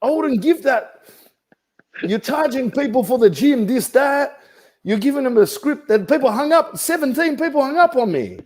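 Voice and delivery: high-pitched comic indignation; indignant rant. Real, not acted. Agent scolding coach over the script he sells, high-pitched indignation. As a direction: pile up the complaint, voice pushed way up.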